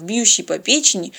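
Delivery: plain reading voice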